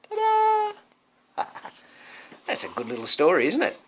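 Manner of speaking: ordinary delivery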